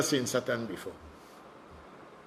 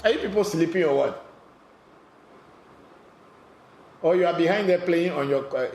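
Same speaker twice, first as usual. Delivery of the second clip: louder, higher teasing scold at the audience; raised scolding; 5 semitones higher, 4 dB louder than usual. Real, not acted. Host scolding silent viewers, raised teasing voice. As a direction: wake them up with a raised voice.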